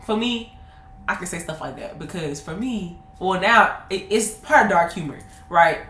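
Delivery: ordinary talk, nothing marked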